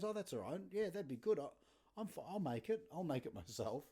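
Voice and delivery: high, eager mock-polite friend; polite guest voice. Mate Matthias insisting he'll make it, high and polite.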